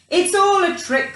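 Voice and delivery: put-on defiant child. A narrator's reading. Child dismissing the mouse story, defiant. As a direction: shrug off the danger. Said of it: "said the Gruffalo's child".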